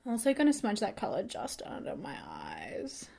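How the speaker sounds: very soft, absorbed half-whisper; hushed, trailing commentary on your own hands; whispery aside. Real, not acted. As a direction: murmur it while concentrating on make-up. Absorbed aside while applying make-up.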